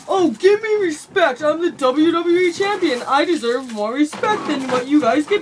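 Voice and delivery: mock his demand for respect; mocking arrogant wrestler. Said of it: "And he's like".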